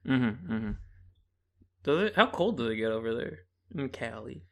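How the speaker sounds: ordinary talk, nothing marked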